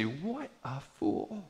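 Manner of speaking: soft, slow, sneering aside; mocking scorn. Church members scorning the vaccine sticker, soft slow sneer. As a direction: sneer it under your breath.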